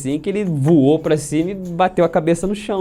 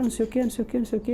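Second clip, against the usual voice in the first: mock babble; 8 semitones higher, 7 dB quieter, much faster than usual. Mocking the other guy's talk.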